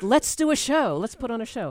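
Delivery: high, loud mock-eager proposal; excited show pitch. Podcaster playfully mimicking let's put on a show, excited.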